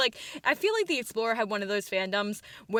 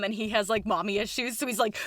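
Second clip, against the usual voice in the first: ordinary talk, nothing marked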